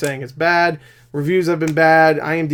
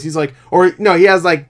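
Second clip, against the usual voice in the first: ordinary talk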